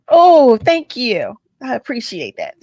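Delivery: loud, slow burst of thanks; real, overwhelmed gratitude. Real, not acted. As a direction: let the "Oh" swell with feeling. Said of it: "I'm just so overwhelmed".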